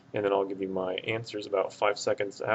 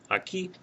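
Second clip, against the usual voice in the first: aquí with a higher-pitched final KÍ; stress at the end; 10 semitones higher, 3 dB quieter than usual. Shown as a demonstration. Teacher demonstrating where the accent mark goes, aquí stressed on KÍ. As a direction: put the stress on the last syllable of aquí. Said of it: "the stress is at the end".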